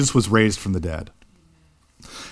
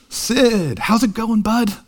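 higher, fond dad greeting his son; dad answering the phone; 9 semitones higher, slower than usual. Retold or imitated. Father greeting son calling home, fondly and higher. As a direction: imitate a delighted father picking up a call. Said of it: "my dad's voice when he picks up the phone".

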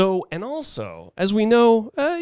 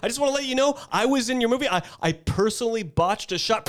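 raised, fast nervous plea; high pleading; 10 semitones higher than usual. Retold or imitated. Pleading with Clint in retold scene.

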